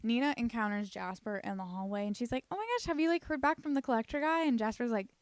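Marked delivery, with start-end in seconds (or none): 2.5-4.4 excited gush. Retold or imitated. Nina excitedly asks Jasper.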